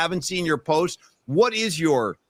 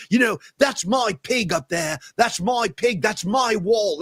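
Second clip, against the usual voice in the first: a touch higher, mock-pompous rock star boasting; 4 semitones higher than usual. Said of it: "[name] was like".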